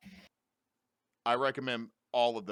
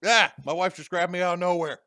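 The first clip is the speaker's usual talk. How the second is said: startled; 9 semitones higher, 13 dB louder than usual. Real, not acted. Startled by wife grabbing him.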